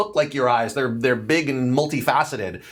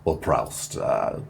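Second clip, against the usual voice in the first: deep creature voice; 6 semitones lower than usual. Acted. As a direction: rumble it like a menacing giant. Creature threatens to crush.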